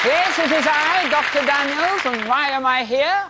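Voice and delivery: high loud stage magician; grand showman voice. Acted. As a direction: announce yourself like a showman.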